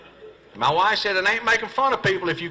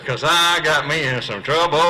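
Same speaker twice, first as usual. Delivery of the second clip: ordinary delivery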